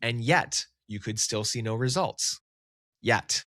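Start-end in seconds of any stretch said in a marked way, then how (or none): 3.0-3.4 "Yet" said alone, firmly stressed. Shown as a demonstration. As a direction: land hard on "Yet" as its own sentence. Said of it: "emphasis on the word yet".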